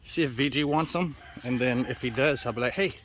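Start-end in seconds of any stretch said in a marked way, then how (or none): none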